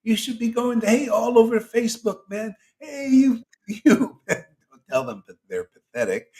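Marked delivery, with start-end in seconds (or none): 0.0-4.2 higher, eager street announcer calling out; playful imitation of Jesus hawking good news; Jesus spreading news. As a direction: hail everyone with good news, much higher. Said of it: "What do you think Jesus going around".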